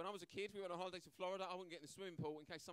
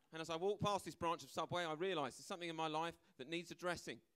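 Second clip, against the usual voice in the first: ordinary delivery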